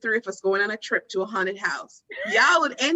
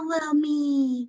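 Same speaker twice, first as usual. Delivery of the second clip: put-on creepy ghost girl; 3 semitones higher, much slower than usual. Ghost girl luring, acted.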